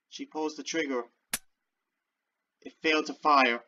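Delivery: ordinary talk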